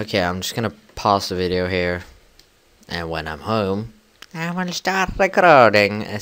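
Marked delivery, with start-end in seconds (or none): 4.3-6.2 high sing-song announcement. Real, not acted. Pausing the video.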